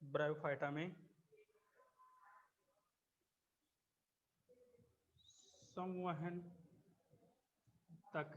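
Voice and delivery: one word at a time with long pauses; spaced-out classroom dictation; slow dictation. Acted. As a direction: dictate slowly so students can write. Teacher dictating notes.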